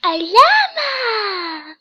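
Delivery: loud, slow, drawn-out cry of joy; overjoyed drawn-out shout; excited. Real, not acted. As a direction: yell it out with delight. Excited reaction to a quiz result, again.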